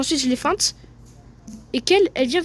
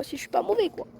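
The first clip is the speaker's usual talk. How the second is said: normal talking voice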